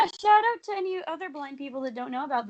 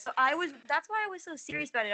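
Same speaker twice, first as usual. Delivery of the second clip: ordinary talk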